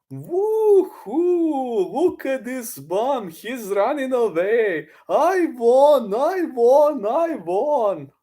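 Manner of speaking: very high strained put-on voice; Charlie Zelenoff impression. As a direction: ham up the deluded fighter. Mocking the fighter celebrating.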